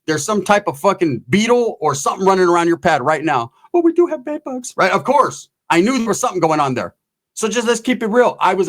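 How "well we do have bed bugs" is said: much higher imitated woman's voice; high-voiced mock confession; admitting woman. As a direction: mimic her reluctant admission up high. Woman's sheepish admission, acted.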